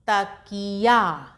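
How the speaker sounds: तकिया drawn out slowly, each syllable held; slow classroom blending; slowly blended word. Shown as a demonstration. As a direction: sound out तकिया slowly for a beginner. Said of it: "तोड़ तोड़ के पढ़ेंगे".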